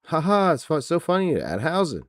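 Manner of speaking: high, put-on laugh into gushing fake amusement; mock laughing. Wrestling fan mocking those who find Danhausen funny, mock laughing. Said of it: "we're all Jimmy Carr".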